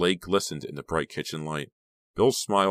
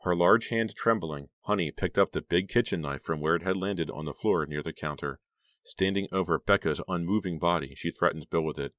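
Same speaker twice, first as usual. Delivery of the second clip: ordinary delivery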